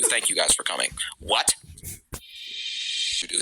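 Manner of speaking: very low, lifeless thank you; monotone commentator sign-off; bored low voice. Retold or imitated. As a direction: mumble it with no energy. Mocking low-energy commentator.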